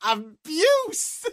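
very high baffled yell; retold screaming. Man screaming misheard word at his cleaner, high yell. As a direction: cry the word out, pitched right up. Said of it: "Screaming Buse at her".

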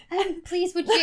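high, loud British pleading; exaggerated British accent. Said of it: "in an exaggerated British accent".

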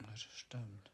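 unvoiced whispered agreement. Real, not acted. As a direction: whisper it softly. Whispered agreement after quiet talk.

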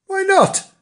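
very high, startled question; great astonishment. A narrator's reading. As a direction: squeak it out like an astonished kitten. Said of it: "said Bernard in great astonishment".

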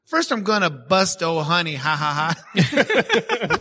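chuckling through the quote; light laugh on the words; kind gentle laugh. Retold or imitated. Retelling friend's joking text.

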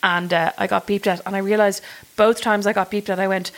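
ordinary talk

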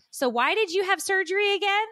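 high, casual-sounding imitation of a commenter; put-on innocent small-talk question; mock nonchalant. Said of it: "You nonchalantly pop up back".

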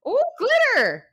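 high, loud delighted squeal; real thrilled shriek; excited. Real, not acted. Glitter appears in her drink.

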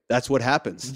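low-key, expressionless retelling; deadpan non-joke; blank deadpan. Retold or imitated. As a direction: say it with a blank face. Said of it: "like, blank face".

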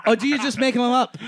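high-pitched rock star impression. Rock star asks whether lines are made up, high impression. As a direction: put on a high, puzzled voice. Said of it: "And he goes".

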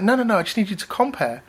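a hint of a plummy Southern English accent; posh voice. Posh Southern English lady correcting the rapper, a hint posh. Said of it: "Really posh voice".

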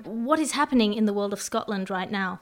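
ordinary delivery

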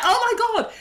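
high delighted oh my God; mock fan-girl squeal; excited squeal. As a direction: squeal with glee. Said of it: "I'm like".

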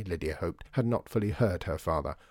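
plain reading voice

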